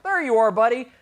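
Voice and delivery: high, loud acted greeting; delighted finding. Shepherd finding his missing sheep, acted delight. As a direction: call out as if just spotting them.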